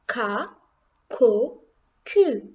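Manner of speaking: "ka, ko, ku" as separate syllables with hard k; hard K sound. Shown as a demonstration. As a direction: model "ka, ko, ku" one by one. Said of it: "make the sound as with the letter K".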